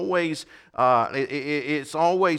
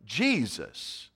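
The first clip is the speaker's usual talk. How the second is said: very slow, measured pronouncement; the voice from heaven answering Saul; voice of Jesus; 4 semitones higher, 4 dB louder, much slower than usual. Acted. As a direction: let each word fall slowly and heavily. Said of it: "and the voice said".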